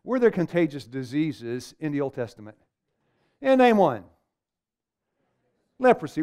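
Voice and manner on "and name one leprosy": raised voice, a higher, louder demand; preaching challenge. Acted. Preacher daring the room to name one, raised voice. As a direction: dare the congregation to name one.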